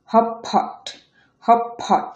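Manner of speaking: হটপট said "hop-pot", first t gone; assimilated T. Shown as a demonstration. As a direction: turn the middle t of হটপট into p. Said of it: "এটা উচ্চারণ হবে".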